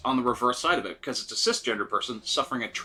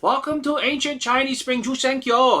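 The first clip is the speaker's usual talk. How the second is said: put-on much higher, louder caricatured Chinese accent; original mock Chinese accent; 11 semitones higher, 6 dB louder than usual. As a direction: parody the old dub's Chinese accent. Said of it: "his accent in the original was".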